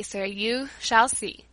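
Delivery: drawn out and slow; slow, knowing repeat. Acted. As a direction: stretch each word, nodding. Teresa repeats slowly, shaking head.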